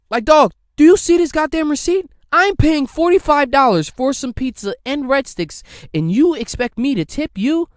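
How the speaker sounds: loud, high-pitched outrage at the delivery guy; yelling at the pizza guy over the tip; exasperated rant. Pretend.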